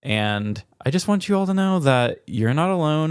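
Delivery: normal talking voice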